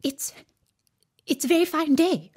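nervous small talk; timid rabbit. A narrator's reading. Rabbit nervously greeting Alice, timid. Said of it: "said a timid voice".

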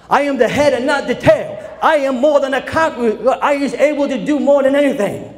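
high, loud, fervent preaching; preacher's rousing declaration of faith; preaching at full force. Acted. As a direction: proclaim it to the congregation.

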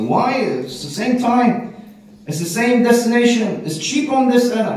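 high-pitched, insistent arguing; incredulous protest. Acted. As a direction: argue the point, acting out disbelief. Acted argument over choosing an airline.

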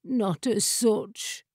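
slow, mournful chair voice. A narrator's reading. The chair admitting it cannot fly, mournfully. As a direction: draw it out in sorrow. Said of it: "mourned the chair".

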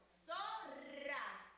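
"zorra" with a strong long trilled rr; strong rolled r. Shown as a demonstration. Teacher stresses the trill in "zorra" for the class. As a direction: roll the rr in "zorra" hard. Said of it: "suena muy fuerte".

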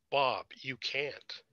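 slow, hushed disbelief; stunned. A narrator's reading. After the judgeship news, slow stunned disbelief. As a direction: let each word drop, numb.

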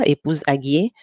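normal talking voice

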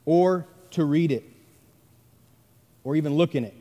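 deliberate pause between each phrase; scripture reading. Acted. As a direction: read it out with solemn weight. Reads scripture verse slowly.

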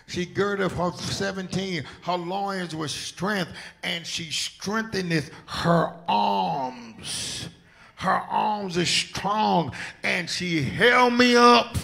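loud, high, slow preaching cry. Real, not acted. Preacher quoting scripture to church, rising preaching whoop.

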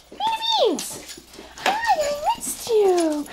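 high, slow excited hello; excited high greeting. Real, not acted. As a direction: gush over the returning dog.

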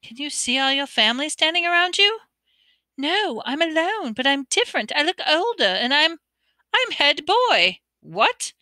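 put-on a frustrated boy, rising; Ron's voice. A narrator's reading.

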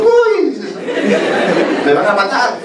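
high-pitched comic yelp of alarm; mock dread. You arriving home and fearing punishment, mock-frightened high yelp. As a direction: yelp as if in big trouble.

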